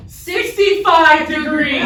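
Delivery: slow, loud punchline shout; loud mock announcement; loud exclaim. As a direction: bellow the number like a reveal. Mocking reading of the phone temperature.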